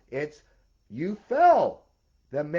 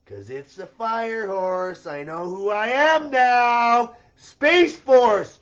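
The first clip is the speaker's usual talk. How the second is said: loud, slow, rising proclamation, much higher; shouting; 7 semitones higher, 11 dB louder, slower than usual. Real, not acted. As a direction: announce it to the world at full voice. Rising rant, self-proclaimed hero.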